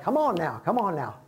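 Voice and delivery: impatient hurry-up. Retold or imitated. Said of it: "they're like".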